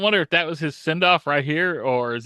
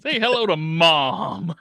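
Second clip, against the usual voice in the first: slow menacing villain; slower than usual. Retold or imitated. Quoting a villain's line.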